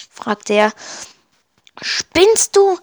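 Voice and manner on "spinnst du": startled, high-pitched outburst; alarmed, voice jumping up. A narrator's reading. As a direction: blurt it out in higher-pitched alarm. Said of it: "entgegnete Kolle erschrocken".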